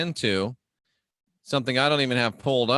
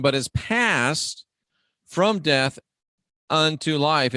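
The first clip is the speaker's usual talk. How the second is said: raised, emphatic Bible-reading voice; higher pitched, deliberate preacher's reading; scripture reading; 4 semitones higher than usual. Acted. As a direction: proclaim the verse in weighty, separated phrases. Reads scripture aloud.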